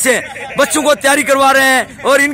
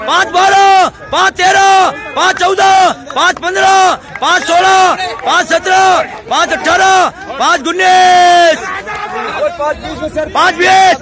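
shouted time calls; 4 semitones higher, slower than usual. Real, not acted. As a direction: call each time out with a stretched ending. Coach calls out times. (ऊँची आवाज़ में समय की पुकार)

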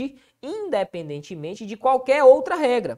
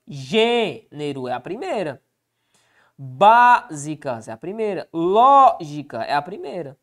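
gênero, Básicas, Lógica, each first syllable hit hard, a bit slowly; teacher marking the strong syllable; stressing the first syllable; 3 semitones lower, 4 dB louder, slower than usual. Shown as a demonstration. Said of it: "Qual que é a sílaba mais forte".

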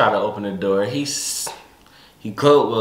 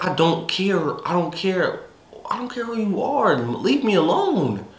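retold angry outburst; 10 semitones higher than usual. Retold shouting at her uncle.

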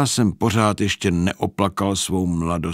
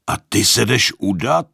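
lashing out; 4 semitones higher, 4 dB louder than usual. Acted. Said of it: "spustil jsem na něho".

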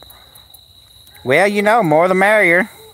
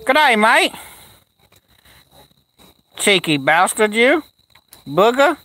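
lightly put-on, higher-pitched Australian greeting; mock Australian accent; 7 semitones higher than usual. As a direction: play at a cheeky Australian accent. Greeting Aussie with mock Australian slang.

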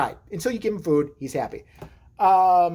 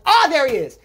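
loud, higher call of recognition; sudden raised-voice exclamation; mock discovery shout; 7 semitones higher, 8 dB louder than usual. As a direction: shout it on spotting him. Calling out on spotting lost baby.

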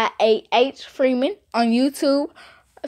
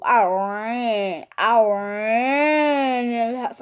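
RAN stretched very long; drawn-out emphasis; much slower than usual. Real, not acted. Telling viewers how fast she fled indoors, drawn-out RAN. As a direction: draw out "ran" for comic effect.

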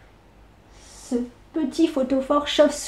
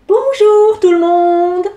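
loud, slow, bright greeting; a happy boy, raised and drawn out; cheerful boy; 6 semitones higher, 6 dB louder, slower than usual. Acted. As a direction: sing out good morning.

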